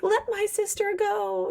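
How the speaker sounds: put-on little boy voice. Rorik brave to Eric, lightly voiced kid. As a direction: puff up like a tiny warrior. Little brother defending his sister.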